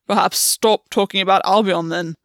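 a loud, angry growl of a line. A narrator's reading. Gwen snapping at August to drop the subject, growled loudly. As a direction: bite it back at him. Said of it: "Gwen growled".

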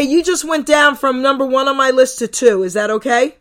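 ordinary talk

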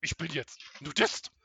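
loud, slow, high character line; film character voice. Acted. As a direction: deliver it like a cheesy movie. Acting film scene.

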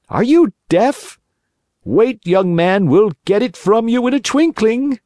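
high, sneering robber threat; menacing thief. A narrator's reading. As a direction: taunt the stubborn boy. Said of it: "thieves threaten the stubborn puppet".

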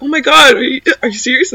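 high-pitched incredulous reaction; shocked disbelief. Retold or imitated. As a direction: imitate friends taken aback. Acts out alarmed friends believing the joke.

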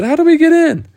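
very high, loud call; shouted question. Acted. Children outside asking the boy at the window, high shout. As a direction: yell it up to someone above.

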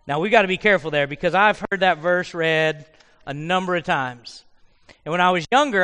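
usual speaking voice

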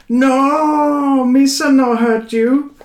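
very high, slow terrified pleading; shrill wail of a tortured victim; wailing Gungan. Acted. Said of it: "The Gungan wails".

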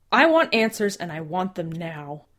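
loud demand for answers; angry. Acted.

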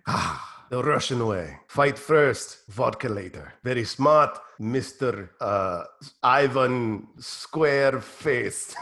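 low, slow Russian commander; Russian handler. Acted. Handler ordering the fighter to fight for Mother Russia, low and slow. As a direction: order him slowly in a deep Russian accent.

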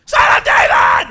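very high, very loud hammed-up scream; all-out shout. Blind beggar drowning out his hecklers, all-out shout. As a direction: belt it out over the crowd. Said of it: "He just got louder".

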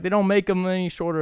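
put-on movie-quote Boston accent. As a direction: imitate a Boston local at the bar. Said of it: "I dig all the accents".

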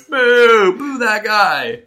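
whole cafeteria booing me; mock booing crowd.

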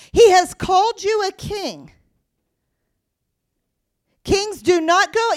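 loud, ringing proclamation to the congregation; loud preaching. Acted. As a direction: call it out with full preaching force. Preacher at full force.

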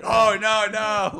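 high, loud mock groan of dismay; a hammed-up "oh no, no" of dread. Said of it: "you both were like".

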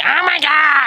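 high-pitched imitation of a mortified friend; mock horror. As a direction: mimic someone appalled, drawn out and high. Imagining the friend hearing this.

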